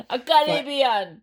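mock Caribbean accent. Jokey Caribbean accent after bank joke.

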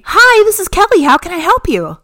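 high, bright phone greeting; chirpy call-center voice; customer service voice. Retold or imitated. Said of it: "I've heard your customer service voice".

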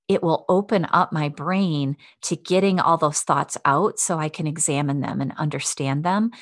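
ordinary talk

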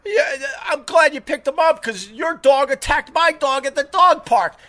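high-pitched accusing retort; retold confrontation. Confronting a dog owner over an attack, retold indignation.

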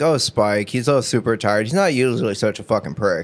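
mock apology; imitating Twilight. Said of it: "Twilight's like".

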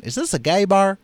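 a hint of a Mississippi mother; Mississippi accent. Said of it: "how's my Mississippi accent".

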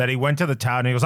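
usual speaking voice

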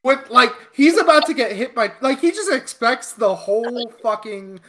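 high, heated disbelief; exasperated rant. Real, not acted. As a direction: rant at the guy's stupidity, pitch way up.

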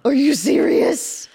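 gasping, slow and delighted; excited gasp. Real, not acted. Excited friend agrees.

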